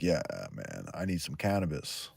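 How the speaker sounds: low, quiet mock stoner. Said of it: "and he's like".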